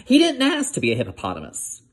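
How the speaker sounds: plain reading voice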